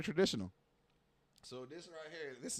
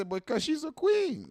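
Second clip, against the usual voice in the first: high, slow mocking voice; mock objector; 12 semitones higher, 4 dB louder, slower than usual. Imagined critics defending the woman, high and slow mocking. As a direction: parody what people will say. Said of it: "They gonna say".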